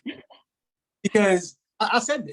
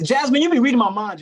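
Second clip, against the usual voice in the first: excited; 6 semitones higher, 7 dB louder than usual. Real, not acted. Host excited by coincidence.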